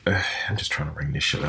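low, soft, quick weary grumble; quiet, exasperated retold complaint; fed-up cashier. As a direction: imitate a worn-out clerk under his breath. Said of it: "That's the expression he had on his face".

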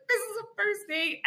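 high, tearful mock wail; retold helpless whimper; near tears. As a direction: plead it, on the verge of crying. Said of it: "on the near thing of tears".

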